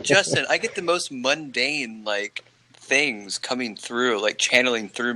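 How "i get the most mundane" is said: usual speaking voice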